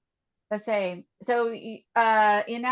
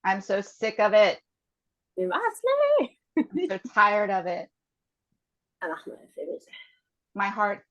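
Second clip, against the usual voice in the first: guided tapping statements. Acted. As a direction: lead each feeling out calmly, one by one.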